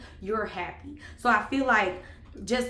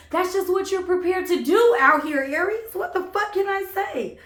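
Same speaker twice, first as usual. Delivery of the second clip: high-pitched, loud exclamation; emphatic outburst; 10 semitones higher, 7 dB louder than usual. Real, not acted. Reader hypes up Aries being single, loud outburst. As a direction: burst out high and insistent.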